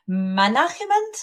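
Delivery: "management" said as "ma-NA-khe-ment"; Spanish-style kh in the middle of "management"; imitated mispronunciation. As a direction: copy a learner's "management", stressed on the second syllable with a throaty kh for the g. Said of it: "I'm trying to, to copy it".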